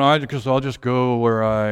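slow, lightly imitated mumble to the floor; resigned, dragging self-talk; dejected boy. Boy always picked last talking to the floor, slow dejected imitation. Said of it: "looking at the floor going".

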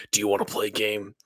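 quiet, creepy horror-movie puppet voice; Saw voice. Retold or imitated. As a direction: lure them into a deadly game. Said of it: "reminds me of the Saw voice".

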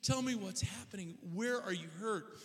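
put-on high, urgent questioning of a crying child; worried parent. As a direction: act out a dad trying to get answers. Father pleads with hysterical son.

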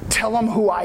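a hint of tender Jesus speaking to her; gentle Jesus. Jesus gently tells the woman to speak, low and tender.

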